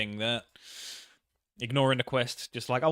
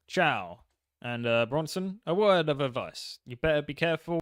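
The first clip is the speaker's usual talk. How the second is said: put-on Italian accent. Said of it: "you gotta do an Italian accent".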